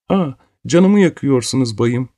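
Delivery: put-on a small child squealing that it hurts; little girl's cry. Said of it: "Küçük bir çığlık attı".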